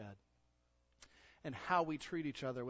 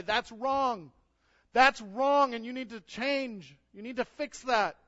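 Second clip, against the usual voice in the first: mock scolding; 11 semitones higher, 14 dB louder, slower than usual. Acted family member scolding.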